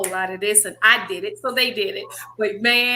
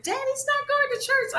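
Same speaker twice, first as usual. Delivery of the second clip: put-on very high piping child voice; imitating little girl. Daughters announcing at the door, high child voice. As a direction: squeak it like a small daughter. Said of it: "they said".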